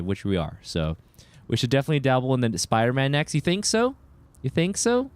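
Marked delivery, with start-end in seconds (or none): 3.3-5.1 mock surprise. Teasing a viewer's suggestion.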